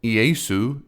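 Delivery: Iesu said as ee-YAY-soo; Greek pronunciation. Shown as a demonstration. Explaining the name's Greek form to viewers, Iesu as ee-YAY-soo. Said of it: "is the Greek way of saying".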